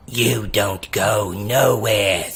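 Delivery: low, slow threatening creature voice; gruff warning. Acted. Horned creature warning the captive party, low and slow. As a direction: lean in and warn them.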